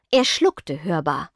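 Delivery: plain reading voice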